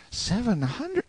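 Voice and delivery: put-on very high boy's voice, incredulous; amazed interruption. A narrator's reading. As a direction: break in astonished, pitched far up. Boy interrupts, amazed at the claim.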